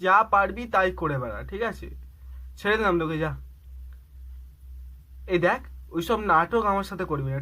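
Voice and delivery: loud, forceful dismissal; heated breakup rant on the phone; angry rejection. Acted. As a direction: cut them off in a temper.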